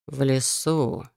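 slow, musing echo of a question; thoughtful drawl. A narrator's reading. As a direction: draw it out, pondering. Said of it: "задумчиво протянул он". (задумчиво, протяжно)

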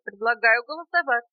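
a hint of a piping little bug; squeaking beetle. A narrator's reading. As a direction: pipe up loudly with a motion. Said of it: "пропищал жучок".

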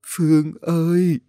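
high, slow, weak call; feeble, failing voice. A narrator's reading. Dying old woman calls for Phương, feeble. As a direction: call out slowly with failing breath. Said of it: "miệng thều thào".

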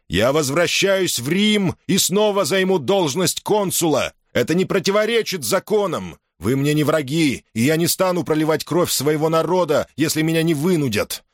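high, ringing address to troops; rally the soldiers like a consul; roaring rallying speech. Acted.

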